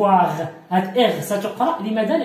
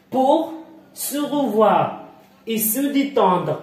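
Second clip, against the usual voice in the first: slow read-along; 2 semitones higher, much slower than usual. Shown as a demonstration. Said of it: "حاول قراءة معي النص".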